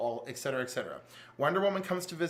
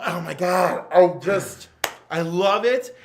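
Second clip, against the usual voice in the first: gushing excitement; 10 dB louder, slower than usual. Real, not acted. Gushing over the comic.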